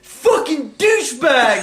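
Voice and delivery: loud, slow furious yell; angry shout. Retold or imitated. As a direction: spit the insult out loud and slow. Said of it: "and he goes".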